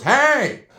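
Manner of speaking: high, sudden holler over a fence; old man calling out. Retold or imitated. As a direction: imitate a neighbor shouting hey. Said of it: "I see an old man who goes".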